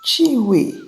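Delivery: high, loud cry of her name; screamed name. A narrator's reading. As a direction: call out sharply to summon her, high and loud. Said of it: "Ikechukwu screamed her name".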